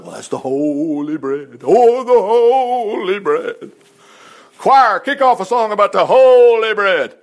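drawn-out, exaggerated holy awe; mock reverence. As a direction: intone it slowly, swelling into a loud pious proclamation.

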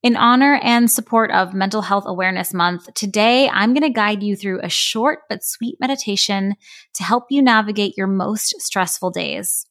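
ordinary talk, nothing marked

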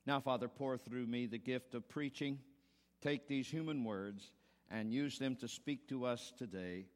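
soft, low prayer voice. Acted. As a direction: pray it quietly and reverently. Opening prayer before sermon.

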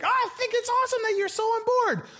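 very high, chirpy mock boss; high giddy boss, clueless and delighted; gushing boss. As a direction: squeal it like a clueless cheery boss. Boss voiced again.

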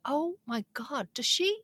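slow, high-pitched mock disbelief; dawning realisation. Patient watching the nurse's pill cart, slow dawning disbelief. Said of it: "it kinda just dawned on me".

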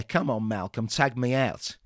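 ordinary delivery

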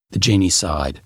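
ordinary delivery

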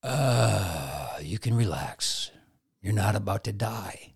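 low, soft, soothing inner voice; calm reassurance. Retold or imitated. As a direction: settle the brain down gently. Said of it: "It says".

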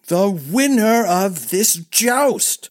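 high, slow, emphatic exasperation; angry cry. A narrator's reading. Prince losing patience with old master, crying angrily. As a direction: cry it out angrily, stretching each word. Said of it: "cried Darren angrily".

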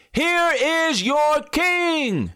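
very high, slow proclamation; slow, very high declaration; Pilate presenting. Acted.

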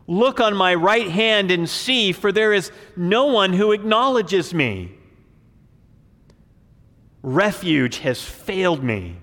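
loud, declaimed psalm, slowing to a heavy close; dramatic scripture reading. Acted. Preacher reading David's psalm to the congregation, declaiming. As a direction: proclaim the lament from the pulpit.

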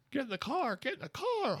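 very high, thin falsetto scream; hammed-up, high-pitched frantic yelling; mock scream. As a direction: scream it in falsetto without full volume. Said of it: "I'm screaming".

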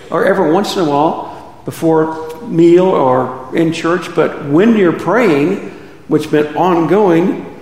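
usual speaking voice

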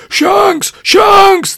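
loud, high-pitched demanding shriek; baby's scream. Acted. Baby Henry demands his shanks, screaming. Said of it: "the baby then did scream".

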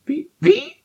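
very high squawking hen voice; clucking hen. Acted. Brown hen squawking in confusion, very high. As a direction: cluck it out like a startled chicken. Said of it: "fragte das braune Huhn".

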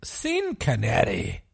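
a quick Scottish Connery impression; mock Scottish accent, slowed right down; Sean Connery Scottish accent. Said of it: "Don't know why I did in that accent".

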